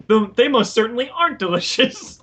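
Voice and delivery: indignant cartoon sponge; lightly voiced SpongeBob; mock SpongeBob. Quoting SpongeBob.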